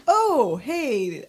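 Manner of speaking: mildly excited, pitch jumps on "Oh". Retold or imitated. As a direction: act out a pleased little realization. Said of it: "I was so excited".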